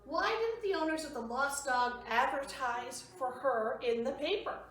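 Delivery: plain reading voice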